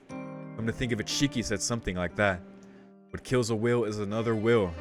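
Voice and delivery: ordinary delivery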